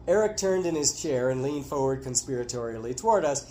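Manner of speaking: ordinary delivery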